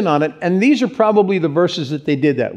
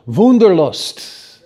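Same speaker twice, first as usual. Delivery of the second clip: slow, emphatic German "Wunderlust"; German pronunciation; 3 dB louder, much slower than usual. Shown as a demonstration. Preacher shows where wanderlust comes from, German accent. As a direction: say "Wunderlust" the German way, with a V sound and drawn out. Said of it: "in the language it comes from".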